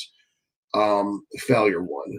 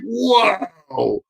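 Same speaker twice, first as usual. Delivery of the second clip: stunned wow; 11 semitones higher than usual. Real, not acted. Reacting to the viewer's dosage.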